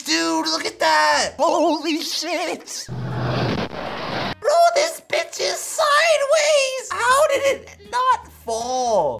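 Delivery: high, slow, shouted disbelief; stunned shouting. Real, not acted. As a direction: yell in amazement, drawn out and high. Stunned by sideways vehicle.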